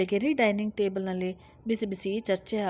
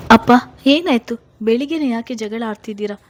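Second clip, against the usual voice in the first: loud, alarmed call to father, then faster; alarmed shout; 3 semitones higher, 13 dB louder than usual. Acted. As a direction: call out over the quarrel, worried. Daughter runs in on fight.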